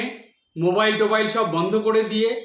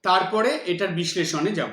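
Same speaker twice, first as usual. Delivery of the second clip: ordinary talk, nothing marked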